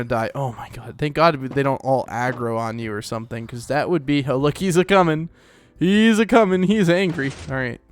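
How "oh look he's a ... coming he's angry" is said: rising alarm, higher and louder. Real, not acted. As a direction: call out as the mob charges. Mob chasing, alarmed calling.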